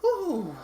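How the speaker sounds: loud, high exhale of relief; relieved whew. Real, not acted. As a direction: let out a big whew. Relief after long session.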